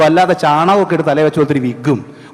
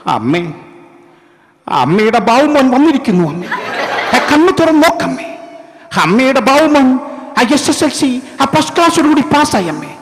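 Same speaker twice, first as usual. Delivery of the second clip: high, hammed-up wailing at a dying mother; Nazir's old crying style; 6 semitones higher than usual. Son wailing at his dying mother, hammed-up high crying. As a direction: overplay the grieving son calling his mother. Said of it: "അന്നത്തെ അദ്ദേഹത്തിൻ്റെ style".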